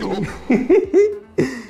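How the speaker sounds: very high tittering laugh; gleeful giggle. Real, not acted. Host enjoys unboxing someone else's things, gleeful high giggle. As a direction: giggle in a high, squeaky run.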